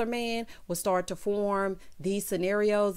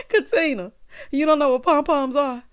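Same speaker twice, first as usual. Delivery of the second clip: laughing disbelief; 6 semitones higher, 8 dB louder than usual. Real, not acted.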